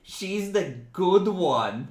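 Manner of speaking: mocking. GM mock praise, slow loud.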